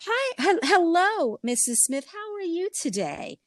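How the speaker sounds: put-on high, overly polite little girl voice; prissy child greeting a teacher in a high voice; prim little girl. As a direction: mimic a too-mature schoolgirl greeting sweetly. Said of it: "she seemed, like, extremely mature, like, too mature".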